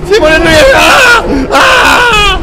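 loud, high screams of alarm; shrieking in panic; panicked scream. Real, not acted. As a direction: scream for your partner mid-attack. Attacked by monsters in game.

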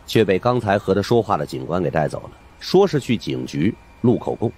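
usual speaking voice

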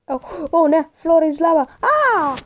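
high frightened whine; panicked. Real, not acted. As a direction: whimper in panic. Panic at lava, crying sound.

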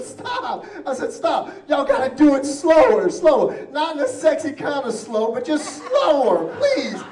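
high, loud pleading scream; screaming stop. Retold or imitated. Patient yelling at nurses, loud high pleading. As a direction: yell at them to slow down. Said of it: "and I scream".

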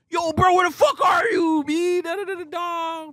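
high, loud shout across the casino; frantic call into the crowd; shouting for friend. Retold or imitated. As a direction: yell for your lost friend. Calling out for friend.

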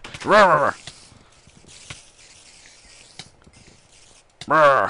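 loud playful roaring rawr; mock monster roar. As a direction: roar at them like a beast. Growling at monsters.

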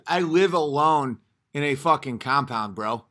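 usual speaking voice

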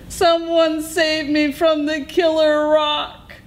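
high wail for rescue; howling. A narrator's reading. Coyote begs to be saved from the rock, howling. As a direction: howl it high and sustained. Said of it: "he howled".

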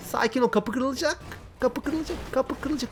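high-pitched, anxious repeating; playful alarm. Real, not acted. Gamer watching the car door about to break, playful alarm. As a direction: fret in a high voice as it escalates.

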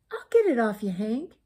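mild storybook shout; calling out. A narrator's reading. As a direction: call out eagerly to help. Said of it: "she shouted".

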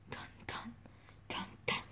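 whispered dun dun; whispered suspense. Acted. Suspense music for the scene, whispered.

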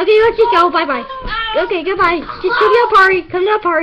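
loud high call; shouting. Real, not acted. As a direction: call it out to everyone. Calling friends to party.

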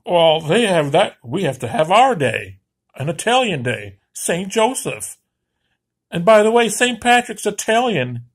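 high, loud mocking whine of a proud Italian. Ranter mocking Italians who want their own day, in a high, loud sneering whine.

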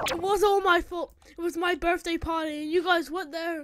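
loud, distraught insistence; anguished self-blame. Acted. Blaming own birthday party, anguished.

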